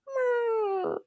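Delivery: a very high, soft squeaky meow; mock meow. The other son answering his dad with a tiny high meow. Son's odd answer, acted quote.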